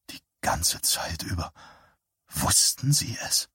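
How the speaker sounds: breathless horrified whisper. Acted. Man staring at the stricken woman, horrified whisper. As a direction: breathe the words, no voice.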